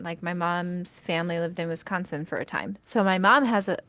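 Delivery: ordinary talk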